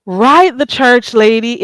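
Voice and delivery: loud, high greeting to the church lady; excited shout-out. Real, not acted. Greeting the church lady in chat, loud and bright. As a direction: call out her name with delight.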